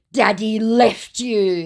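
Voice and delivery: slow, high mocking taunt; hammed-up scammer. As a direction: sneer it like a petty troll. Voicing the scammer's insult.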